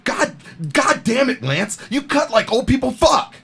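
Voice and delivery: hammed-up angry coach tearing into a player; mock coach yell. Retelling how the coach chewed out Lance, mock yell.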